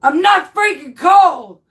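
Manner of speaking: high, loud angry retort; mock shout. Wife yelling at her sleepwalking husband, mock shout. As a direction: yell it at the sleepwalker. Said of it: "was like".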